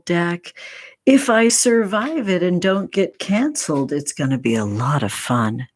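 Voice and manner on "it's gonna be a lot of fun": usual speaking voice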